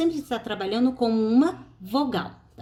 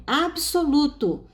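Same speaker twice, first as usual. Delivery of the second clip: absoluto said slowly, syllable by syllable; slow teacher demo of absoluto; 4 semitones higher, slower than usual. Shown as a demonstration. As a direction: spell out absoluto slowly for the class. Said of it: "Por exemplo".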